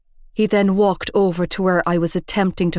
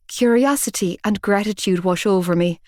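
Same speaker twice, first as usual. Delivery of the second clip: ordinary delivery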